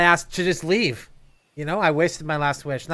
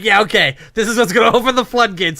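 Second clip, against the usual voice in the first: excited; 4 semitones higher, 6 dB louder than usual. Retold or imitated. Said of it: "It would just be like".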